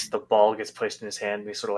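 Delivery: ordinary talk, nothing marked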